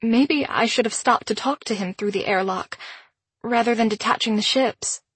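ordinary delivery

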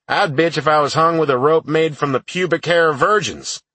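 gruff barked retort. Acted. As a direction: bark it back at him. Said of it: "Sarge barked".

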